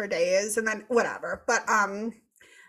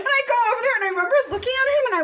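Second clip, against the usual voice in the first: excited buildup; 13 semitones higher, 6 dB louder, much faster than usual. Real, not acted. Building up to the angry quote.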